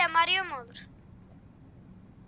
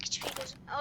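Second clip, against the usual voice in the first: whispered complaint; 7 dB quieter, much faster than usual. Real, not acted. Gamer in a dark level, whispered aside. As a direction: breathe it out under your breath.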